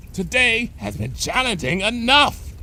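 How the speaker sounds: high, strained pony voice; cried out. Acted. Bon Bon crying out while shoving Lyra's behind. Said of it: "Bon Bon cried".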